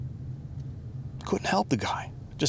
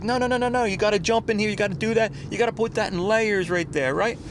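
high-pitched, exaggerated mock daughter voice; imitating daughter; 10 semitones higher than usual. Dad mimics daughter taking over the haircut, high caricature. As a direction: put on a high voice for a daughter's instructions. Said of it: "My kid don't talk like that".

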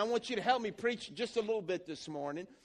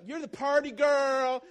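mocking label; 5 semitones higher, 7 dB louder, slower than usual.

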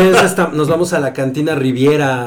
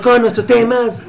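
ordinary delivery

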